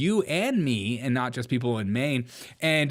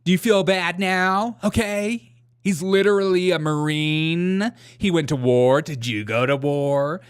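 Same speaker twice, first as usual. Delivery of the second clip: mocking whiny voice; 4 semitones higher, 4 dB louder than usual. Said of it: "mocking defenders of him".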